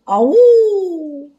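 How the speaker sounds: high, loud drawn-out howl; long "awoo" sent across the park; wolf howl. A narrator's reading. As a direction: howl out like a werewolf. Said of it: "A howl echoed through the park".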